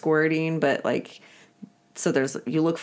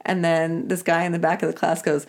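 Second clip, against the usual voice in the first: ordinary delivery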